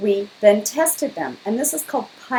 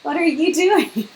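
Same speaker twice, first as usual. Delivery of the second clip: high, pushy mock \"Hey, what are you doing?\"; playfully jam a phone in her face; hammed-up nosy interviewer, high-pitched; mock intrusive greeting; 8 semitones higher, 4 dB louder, slower than usual. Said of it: "holding it up to her face and saying".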